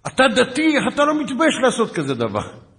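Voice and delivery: very high, loud imitated tirade; high-pitched mock screaming; screaming scolding. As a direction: yell it like an outraged sergeant. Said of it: "הוא התנפל עליי בצרחות".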